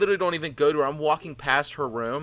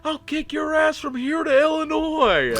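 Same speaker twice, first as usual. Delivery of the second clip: high, drawn-out mock holler; imitated patient yelling; 14 semitones higher, 5 dB louder, slower than usual. Crazy patient to passers-by, high mock yelling. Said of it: "she's yelling at people walking by".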